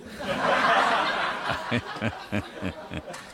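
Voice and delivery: carcajada sin voz, soplada; ríete a carcajadas del chiste; risa fuerte. Real, not acted. (riendo a carcajadas de su propio chiste)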